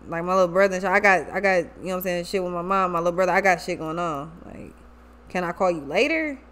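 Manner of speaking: low, subdued imitation of a man making excuses; Spencer. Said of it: "He like".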